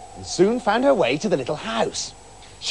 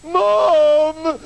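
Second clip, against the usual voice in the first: very high, loud wail; 12 semitones higher, 5 dB louder than usual. A narrator's reading. Daughter wails to her mother, high and loud. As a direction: cry out for her mum. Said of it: "the daughter wailed".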